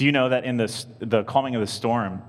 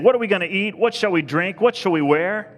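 high, loud fretting questions; anxious questioning; 10 semitones higher, 7 dB louder than usual. Acted. Preacher quoting the anxious worriers, high-pitched fretting. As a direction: fret aloud, pitched high. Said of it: "don't be anxious, saying".

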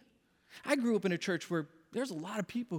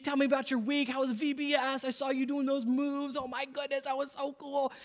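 gushing teacher; 6 semitones higher than usual. Retold or imitated. Teacher gushing to his kids.

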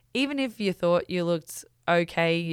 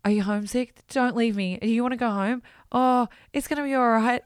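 higher-pitched, mildly panicky retelling; a touch of panic, getting louder; mock freaking out; 5 semitones higher, 4 dB louder than usual. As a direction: imitate a partner starting to freak out. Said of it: "he starts freaking out".

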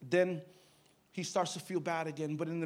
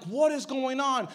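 loud, high, drawn-out question; exasperated cry; 7 semitones higher, 9 dB louder, slower than usual. Retold or imitated. Preacher questioning God over the sick boy, exasperated cry. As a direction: raise the voice and stretch each word. Retold bewildered cry during crisis.

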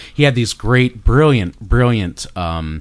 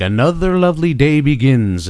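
Vincent Price voice; 5 semitones higher, 3 dB louder than usual. Retold or imitated. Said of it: "So he'd be like".

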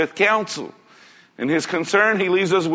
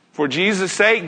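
ordinary delivery

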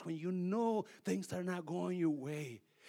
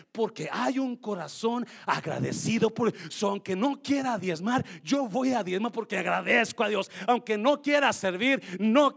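loud, driving sermon delivery; preaching at full force; 6 semitones higher, 12 dB louder than usual. Acted. Pastor rousing the congregation about gratitude, loud preaching. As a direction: preach it to the back row.

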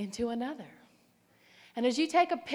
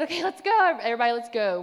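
exasperated rallying call; 10 dB louder, faster than usual. Retold or imitated. Fed-up runner getting the girls moving, mock exasperated. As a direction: round up the group, loudly and briskly. Retold rallying, annoyed by friends.

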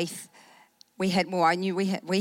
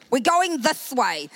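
louder, higher, slow and firm; mad; 6 semitones higher, 5 dB louder, slower than usual. Retold or imitated. Angry woman at the wheel refusing her friends' directions, firm and loud. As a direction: plant each word, no arguing. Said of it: "And I'm just mad".

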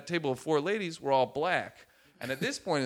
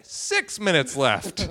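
slow, louder mock announcement; countdown announcement; 3 semitones higher, 5 dB louder, slower than usual. Comic bombing, reading his watch aloud, announcing. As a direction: call out the time, drawn out. Retold countdown during bombed set.

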